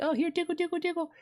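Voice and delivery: tiny, soft, very high squeak; mock tickling voice. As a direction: mimic feeble attackers in soft falsetto. Mimics feeble enemies barely tickling him.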